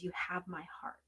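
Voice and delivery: soft hushed whisper, no voice; whispered message. Real, not acted. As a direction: breathe it like a secret from mom. Relays mother's message to daughter.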